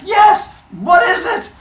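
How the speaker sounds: very high, loud eager yell; eager shout. Retold or imitated. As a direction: shout it out impatiently. Zeus eager for cure.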